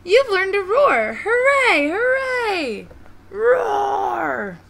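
high, loud cheering that ends in a roar; proud shouting. Acted. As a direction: cheer at the top of the voice. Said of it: "brothers and sisters shouted proudly".

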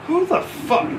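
high, sharp startled challenge; indignant who the fuck. Retold or imitated. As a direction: snap it out, annoyed. Recruit grabbing a Marine's uniform.

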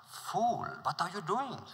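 horrified prince crying out at his friend. Acted. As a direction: cry out in horror at the food. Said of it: "horrified, cried out".

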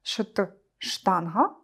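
slow, sounding out "Шт" before "штанга"; drawn-out "Шт, штанга" with a rise at the end; consonant cluster drawn out. Shown as a demonstration. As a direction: isolate the cluster "Шт", then say "штанга" slowly. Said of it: "Скупчення приголосних, які незручно вимовляти".

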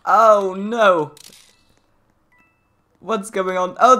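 usual speaking voice

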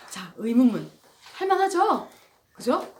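usual speaking voice

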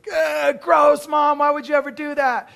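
high, loud grossed-out yell; disgusted shout. Retold or imitated. Son yelling at mom about the brownies, high and disgusted. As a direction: yell it like a kid who's been tricked.

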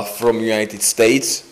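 ordinary talk, nothing marked

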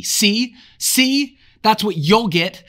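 high, loud frenzied triumph; wild screaming. Acted. Jack screams at Ralph after Piggy dies, wild screaming. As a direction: shriek it out in wild glee. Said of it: "began screaming wildly".

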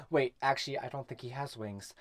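suddenly low and very soft; quiet second thought; muttered aside. Acted. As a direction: drop out of the rant to think aloud. Aside breaking off an angry rant.

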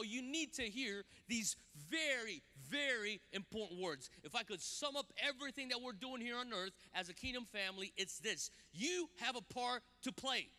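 usual speaking voice

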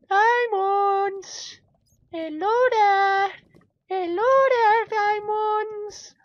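very high, loud squeaky falsetto; silly calling voice. Pretend. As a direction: coo loudly to hidden treasure in falsetto. Calls to diamonds in silly voice.

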